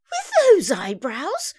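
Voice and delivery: high, drawn-out scorn; scornful declaration. A narrator's reading. Mum rejects the idea of Eric, scornful. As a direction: dismiss him incredulously, stretched and high. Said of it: "Mum declared".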